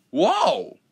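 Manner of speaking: high loud cry of surprise; be blown back by the rush; startled whoa. Acted. Engine startled as Gordon speeds past.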